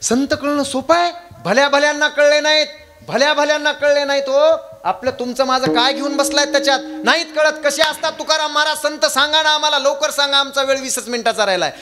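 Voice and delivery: high, urgent sermon declamation; impassioned preaching. Real, not acted. Kirtankar urges Tukaram to describe saints, impassioned preaching. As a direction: press the questions home in a high, ringing voice.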